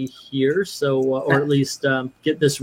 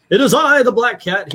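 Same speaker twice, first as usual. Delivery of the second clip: grand self-announcement; 6 semitones higher, 4 dB louder than usual. Pretend. Reading chat message in grand voice.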